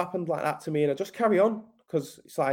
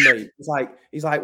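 ordinary delivery

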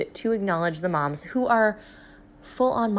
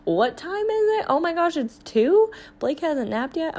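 high-pitched startled realization; mock panic; 4 semitones higher than usual. Retelling losing track of time on the trip, flustered and high. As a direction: gasp at the clock, flustered.

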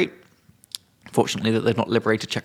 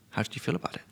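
whispered quote; 3 semitones higher, 12 dB quieter, much faster than usual. Retold or imitated. Retelling a student's cheeky question to co-host, whispered. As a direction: whisper how did you feel about it. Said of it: "she was like".

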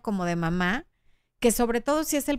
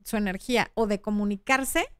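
ordinary talk

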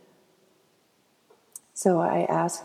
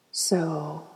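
guided meditation. Acted. Host turning listeners' energy inward, guided meditation. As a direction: let each phrase settle before the next.

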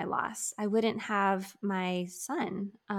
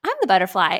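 put-on louder, bright little-girl declaration; toddler's proud announcement; 11 dB louder, much faster than usual. Daughter to mother, a loud proud toddler claim. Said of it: "she's like".

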